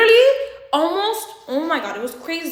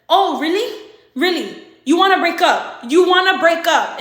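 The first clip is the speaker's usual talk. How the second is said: loud, incredulous retold challenge; higher, punchy mock disbelief; 4 semitones higher, 8 dB louder than usual. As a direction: imitate a boyfriend calling the bluff. Acted boyfriend challenges break-up threat.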